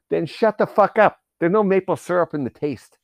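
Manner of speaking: loud, high-pitched exasperated telling-off; mock angry rant. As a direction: tell off the waiter in hammed-up anger. Acted quarrel over menu talk.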